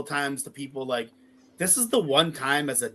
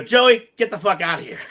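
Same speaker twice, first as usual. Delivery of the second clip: laughing; 7 semitones higher, 6 dB louder than usual. Retold or imitated. Teasing toast on the boat.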